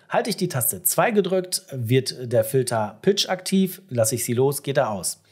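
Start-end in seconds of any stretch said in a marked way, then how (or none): none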